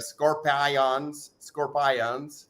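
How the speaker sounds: scorpions stretched into "scor-PIE-ons", slowly; mock mispronunciation. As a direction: say scorpions to rhyme its middle with Zion, full "eye-on". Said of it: "I was trying to mock you for saying Zion".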